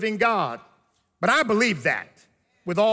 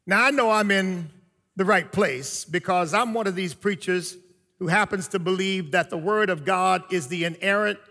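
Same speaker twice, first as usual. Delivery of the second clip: ordinary talk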